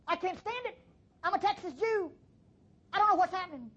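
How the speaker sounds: anxious worshipper. Acted.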